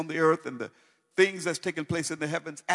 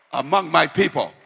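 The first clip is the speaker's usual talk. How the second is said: full-force preaching; 4 semitones higher, 5 dB louder than usual. Acted. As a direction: preach it loud, rising and ringing.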